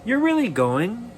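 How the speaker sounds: lightly voiced mother, surprised. Mother surprised her son leaves.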